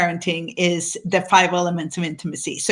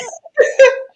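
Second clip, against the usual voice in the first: high burst of laughter; bright laugh; 5 dB louder than usual. Real, not acted. Co-host laughing at the celebration, high laugh. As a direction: laugh along at the joke.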